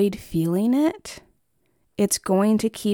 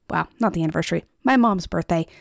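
ordinary talk

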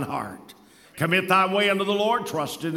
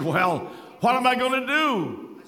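higher-pitched mock-anxious plea; retold terror; 6 semitones higher than usual. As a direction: imitate a panicked churchgoer begging for advice, mildly. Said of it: "in terror".

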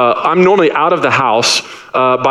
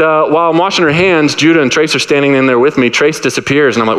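usual speaking voice

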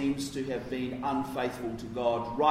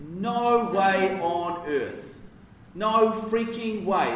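slow, higher, stretched "No freaking way"; emphatic; 4 semitones higher, much slower than usual. Shown as a demonstration. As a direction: drag out "No way on earth" for emphasis. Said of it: "It's emphatic".

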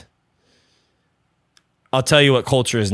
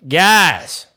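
a light country accent, higher and louder; little country accent; 6 semitones higher, 4 dB louder, slower than usual. Real, not acted. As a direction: greet them in a slight Southern country drawl. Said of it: "I got this little country accent".

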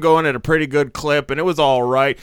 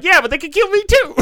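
very high, loud retort; mock indignant comeback; 7 dB louder than usual. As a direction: fire back in exaggerated indignation. Retold indignant comeback from the uncle.

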